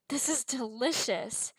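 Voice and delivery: slow, slightly strained after spicy food; hoarse from spice. A narrator's reading. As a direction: push through burning mouth. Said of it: "coughed a bit".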